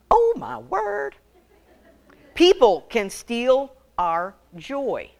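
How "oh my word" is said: high, drawn-out oh my word; mock exasperation, pitched high; exasperated exclamation. Real, not acted. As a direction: throw the oh way up in mock dismay.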